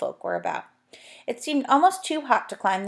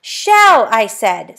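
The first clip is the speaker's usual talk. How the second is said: louder insistence; 11 dB louder, much slower than usual. Acted. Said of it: "said Fanny".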